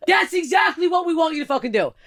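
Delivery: high, emphatic comeback; exasperated retort. Retold or imitated. Exasperated retort to retold heckler.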